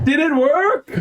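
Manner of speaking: high call from the next room; heard calling out. Acted. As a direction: echo a distant friend's shouted question. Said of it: "you all hear".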